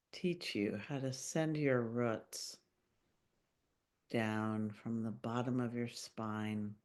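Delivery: calm meditation voice with long pauses; unhurried, gently guiding listeners into their bodies; guided meditation. Acted. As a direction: lead a grounding visualization, phrase by phrase, leaving long silences. Leads a grounding meditation.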